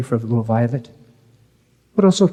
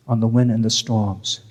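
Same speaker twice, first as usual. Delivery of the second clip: ordinary delivery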